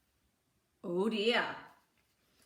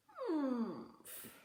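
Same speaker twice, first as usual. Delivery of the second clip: grumpy humph; 3 semitones higher, 9 dB quieter than usual. Acted. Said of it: "said Norman".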